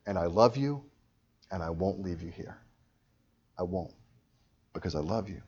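dropping soft and low; tender reassurance. Acted. Jesus promising love to a sinner, softly reassuring. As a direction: settle into a quiet, loving promise.

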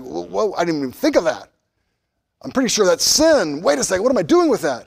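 high-pitched, startled protest; taken aback. Acted. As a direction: act out baffled alarm at a shocking idea. Acting out reaction to jarring opinions.

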